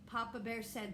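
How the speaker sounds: ordinary delivery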